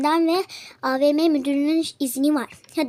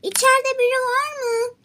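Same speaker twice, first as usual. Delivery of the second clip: calling out; 8 semitones higher, 3 dB louder than usual. Acted. Guard calls into the shop.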